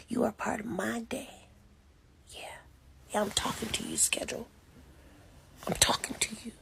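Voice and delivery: hushed, determined self-talk; whispered pep talk. Real, not acted. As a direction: whisper it, fierce little pep talk to yourself. Whispering at her schedule.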